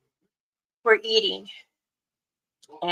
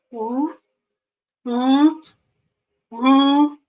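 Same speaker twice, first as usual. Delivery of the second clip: imitated whoop calls; 6 semitones higher, 6 dB louder, much slower than usual. Shown as a demonstration. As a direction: mimic distant whoops growing louder each time. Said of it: "and it gets louder".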